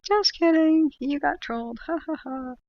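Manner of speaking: high, loud teasing just kidding; lightly voiced prankster gloat; imagined troll gloating. Imagined chatter revealing the troll, high teasing. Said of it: "she'd be like".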